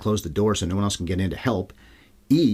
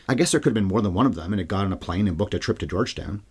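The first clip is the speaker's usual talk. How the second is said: ordinary talk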